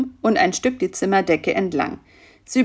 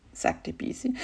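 ordinary delivery, nothing marked

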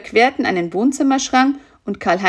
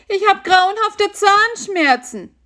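high, moaning spider voice; a small spider groaning with toothache; whiny spider in pain; 13 semitones higher, 4 dB louder, slower than usual. Acted.

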